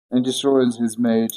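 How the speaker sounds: ordinary delivery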